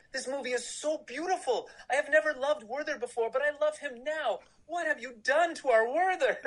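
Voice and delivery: put-on high-pitched gushing German fan, no accent; imitating delighted German fan. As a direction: squeal his praise up high, grinning. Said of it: "he was like".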